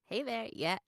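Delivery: ordinary talk, nothing marked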